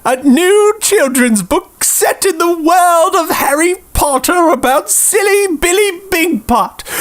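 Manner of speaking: ordinary talk, nothing marked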